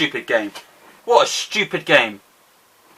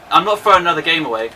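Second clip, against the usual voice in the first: usual speaking voice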